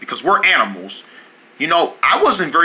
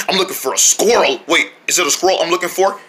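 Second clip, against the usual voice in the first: usual speaking voice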